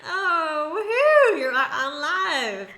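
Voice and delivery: cheer, near tears with joy; excited cheering. Real, not acted. Caller says she'll cry.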